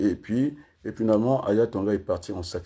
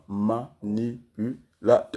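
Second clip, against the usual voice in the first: slow, each syllable separate; much slower than usual. Shown as a demonstration. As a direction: count out the word beat by beat. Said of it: "Là, je suis à quatre syllabes".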